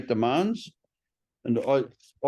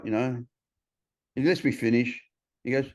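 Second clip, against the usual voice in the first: usual speaking voice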